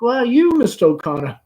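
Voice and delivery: high, loud coquettish exclaim; mother fluttering at the caller; Amanda flirting. Acted. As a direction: gush at the guest.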